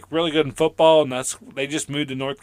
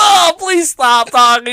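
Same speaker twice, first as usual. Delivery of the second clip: mock annoyed plea; 12 semitones higher, 7 dB louder, slower than usual.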